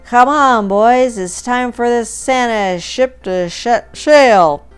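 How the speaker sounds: loud, high, slurred rallying cry; tipsy captain trying to sound in command; drunken slurred command. A narrator's reading. Drunk Ben rallying the crew for the parade, slurring. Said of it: "slurred Ben, trying to maintain an air of command".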